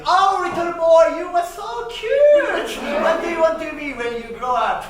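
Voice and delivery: high, loud cooing adult voice; doting grown-up. Retold or imitated. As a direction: coo at the cute boy. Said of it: "people would say to me".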